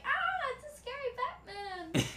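high, panicked shriek; panicked villagers. Pretend. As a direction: squeal in mock terror. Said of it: "they're gonna be like".

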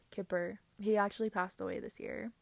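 ordinary talk, nothing marked

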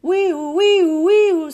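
loud, high imitation siren; siren wail. A narrator's reading. Police car clearing the road, siren wail. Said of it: "said a siren".

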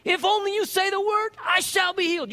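high, loud preacher's shout; bold declaration to God. Acted. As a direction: proclaim it boldly back to God. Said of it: "You tell God like that".

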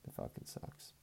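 breathy whispered curse; whispered sympathy curse. Real, not acted. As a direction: drop to a whisper and mutter it. Whispered aside after condolence.

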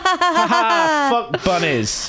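high, put-on cackling; mock evil cackle. Podcasters playing bunny haters for a snippet, mock cackling. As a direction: cackle it like a gleeful villain. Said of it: "And [name] joins in cackling".

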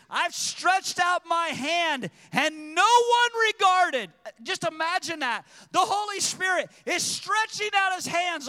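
loud, high, impassioned pulpit cry; full-force preaching. Acted. Preacher crying out Wisdom's rebuke to the congregation, full-force shouted preaching.

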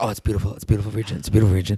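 low, lightly voiced fatherly admiration; a hint of an approving father; imitating dad. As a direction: drop low and repeat it like a pleased dad. Voices her Italian dad admiring region.

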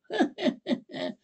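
very high eerie witch cackle; demonic witch cackle. Acted. Witch Hester appearing before the judge, high demonic cackle. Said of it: "demonic witch laugh".

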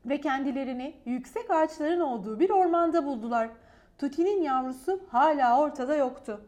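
plain reading voice

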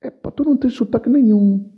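high, smug put-on voice mimicking a snob; mocking condescension. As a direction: sneer it like a know-it-all Lisbon snob. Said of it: "aqui tá o lado chauvinista e supremacista".

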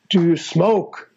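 "Do you smoke?" drawn out slowly; slow, pointed question. Retold or imitated. Drummer retells his idol hinting at pot, slow and pointed. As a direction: imitate someone slowly spelling out a loaded question. Repeats question with a hinted meaning.

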